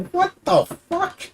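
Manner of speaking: very high, drawn-out squeal of disbelief; outraged disbelief. Retold or imitated. Reacting to a video of an attack, appalled.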